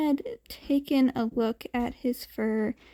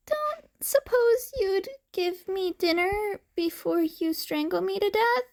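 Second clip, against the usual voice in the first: put-on higher, sweet little kid voice begging; sweet pleading kid; 7 semitones higher than usual. Hungry cat boy sweetly begs the man for dinner, high and pleading. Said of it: "He gave the man a sweet smile and pleading eyes".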